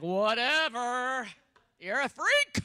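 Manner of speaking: drawn-out whatever, then loud jeer; mock a date's loud, disgusted dismissal; scornful rejection. Date scoffs at the believer.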